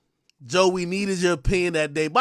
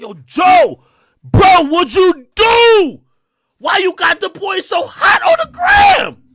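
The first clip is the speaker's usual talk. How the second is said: high, loud, slow hammed-up yell down the phone; mock yell; 10 semitones higher, 7 dB louder, slower than usual. Calling [name] about [name]'s post, hammed-up shouting. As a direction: yell at your friend in mock alarm. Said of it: "I said".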